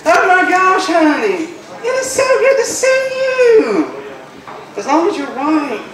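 high, slow sugary Southern drawl; southern Georgia lady. Retold or imitated. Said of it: "where you may hear someone say".

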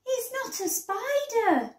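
high-pitched protest; mild shout. A narrator's reading. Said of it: "Daisy shouted".

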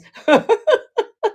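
loud, high cackling laugh; high laughter. Real, not acted. Giggling fit with viewers.

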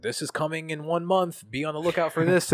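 mock hype announcement. Mocking his own old social posts, hyped-up announcer tone. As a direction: sell the empty promise like a promo.

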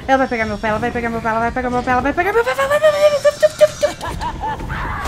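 terror building to a shriek; being chased. Real, not acted. Monster closing in during chase.

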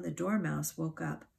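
ordinary delivery

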